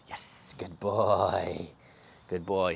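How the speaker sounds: slow whispered praise. Real, not acted. Trainer calmly rewarding puppy in a slow whisper.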